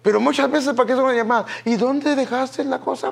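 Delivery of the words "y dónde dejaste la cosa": high, slow, nagging question; mock wife on the phone. To her husband on the phone, a mock nagging question. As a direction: drag out the question like a nagging spouse. Wife's phone call acted.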